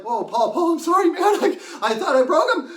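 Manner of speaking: laughing apology. Retold or imitated. Apologizing to frightened friend.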